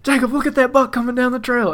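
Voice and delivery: high, loud excited hiss-free call to a friend; urgent, thrilled alert; pointing out a huge buck to Jacob, excited; freaking out. Retold or imitated. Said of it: "I start freaking out".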